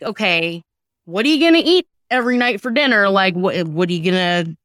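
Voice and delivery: ordinary talk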